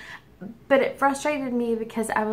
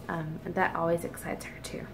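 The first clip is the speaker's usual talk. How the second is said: usual speaking voice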